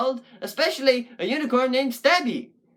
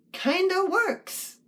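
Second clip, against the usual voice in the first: high, drawn-out doubtful lilt; tentative approval; 11 semitones higher, much slower than usual. Real, not acted. Puppet poet weighing a phrase for the poem, high tentative lilt. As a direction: stretch it slowly way up high.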